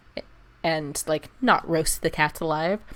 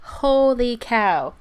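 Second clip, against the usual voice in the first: drawn-out exclamation; 5 semitones higher, 5 dB louder, much slower than usual. Real, not acted. Complaining about heat.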